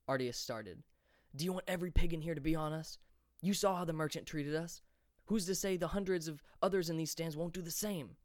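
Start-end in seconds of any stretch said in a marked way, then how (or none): none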